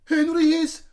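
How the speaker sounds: high, very loud shout; shouting tattletale. A narrator's reading. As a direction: shout to tell on him. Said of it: "shouted moody Margaret".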